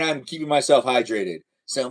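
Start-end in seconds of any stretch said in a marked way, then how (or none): none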